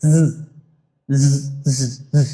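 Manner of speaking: Z as a low buzzing "zzz"; buzzing sound. Shown as a demonstration. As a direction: buzz the Z, don't name the letter. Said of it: "it's a buzzing sound".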